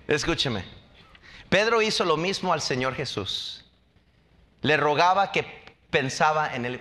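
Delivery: ordinary delivery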